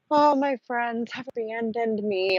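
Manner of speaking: slow, drawn-out mock lament, lightly hammed; mock self-pity. Host jokingly mourning to viewers, slow mock self-pity. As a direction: sigh it out like a forsaken martyr.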